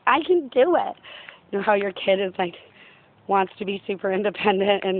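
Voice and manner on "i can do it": put-on stubborn child. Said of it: "we're like".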